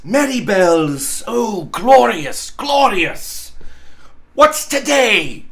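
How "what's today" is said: Scrooge calling down, higher and louder. Acted. Scrooge calling down to a boy below. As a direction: call to a boy in the street. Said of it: "cried Scrooge, calling downward to a boy".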